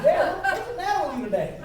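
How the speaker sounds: high laughing mock of a baffled teen; laughing son quote. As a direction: voice the puzzled son through laughter. Son's puzzled quote, laughing.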